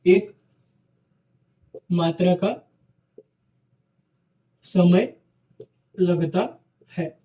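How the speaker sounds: slow dictation, each word spaced for note-taking. Shown as a demonstration. Teacher to students: slow dictation.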